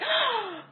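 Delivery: sharp high gasp of delight; joyful gasp. Retold or imitated. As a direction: gasp in happy surprise. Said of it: "smiling with joy".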